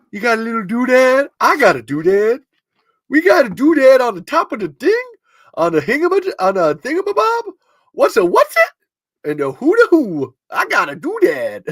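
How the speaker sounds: high, sing-song goofy doodad riff; silly high playful riff. Pretend. Host riffing on the word doodad, high and silly. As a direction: toy with the word like a kid. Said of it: "doodads is a funny word".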